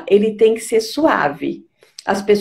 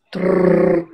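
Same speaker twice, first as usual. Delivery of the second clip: gentle tongue trill; 2 semitones lower than usual. Shown as a demonstration. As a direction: demonstrate an easy vibrating trill. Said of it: "bem suave".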